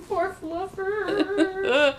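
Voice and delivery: high, drawn-out fake sob; mock wailing. Listener mourning the buried cat, mock crying. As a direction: stretch it into a laughing, tearful whine.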